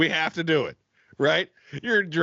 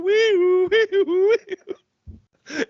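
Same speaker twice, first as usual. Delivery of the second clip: high, loud ambulance siren; siren imitation; 5 dB louder, much slower than usual. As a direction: wail like an ambulance.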